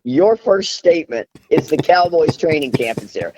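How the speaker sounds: high, incredulous scolding; exasperated retort. Retold or imitated. Wife scolds husband Charlie with incredulous exasperation. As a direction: throw it back at him in disbelief. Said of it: "I'm like".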